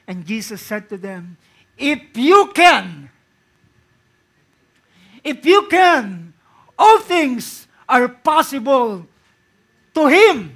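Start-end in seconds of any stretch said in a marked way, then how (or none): 1.8-10.5 loud, slow and high proclamation; preacher booming Jesus' answer to the father; preaching at full force. Acted. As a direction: thunder each word out slowly.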